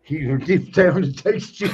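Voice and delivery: slow, laugh breaking into the words; real laughter through speech. Real, not acted. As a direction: tell the punchline while cracking up. Laughing at own punchline.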